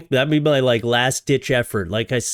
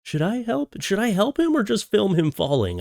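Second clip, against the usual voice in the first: mock bystanders; 8 semitones higher than usual. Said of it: "So many people, like".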